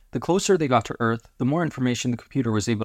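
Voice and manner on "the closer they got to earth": ordinary delivery, nothing marked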